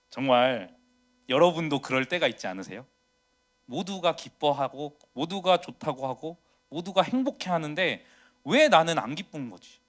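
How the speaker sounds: ordinary delivery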